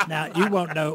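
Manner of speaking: usual speaking voice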